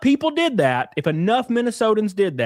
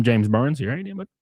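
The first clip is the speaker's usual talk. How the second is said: normal talking voice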